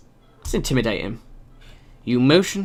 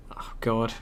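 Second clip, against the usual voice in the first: normal talking voice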